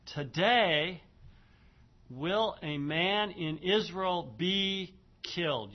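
TODAY loud and high, then a pause; deliberate pause. Shown as a demonstration. Teacher showing students the pause in a verse, deliberate pause after TODAY. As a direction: set TODAY apart, then slowly read on. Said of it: "Notice the pause".

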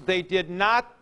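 normal talking voice

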